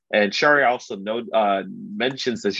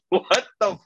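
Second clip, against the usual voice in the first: shocked disbelief; 8 semitones higher, slower than usual. Retold or imitated. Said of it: "And they're like".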